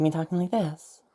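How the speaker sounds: lightly lifted demonstration voice. Shown as a demonstration. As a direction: lift the tone a little. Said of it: "and a lot higher tone".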